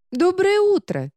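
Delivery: high, loud bright greeting; cheerful book voice. Acted. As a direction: sing out a good morning. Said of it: "голос книги".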